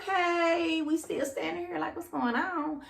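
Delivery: high, softened, bemused reaction; puzzled inner voice. Retold or imitated. Woman recounting a long wait on a date, high and puzzled. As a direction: voice your confusion in a small high pitch.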